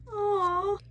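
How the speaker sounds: a high crying aww; tearful aww. Real, not acted. Reader moved by the scene, a tearful high aww. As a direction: let out a teary, high-pitched aww.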